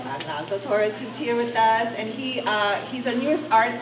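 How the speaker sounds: ordinary talk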